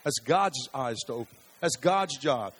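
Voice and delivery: ordinary delivery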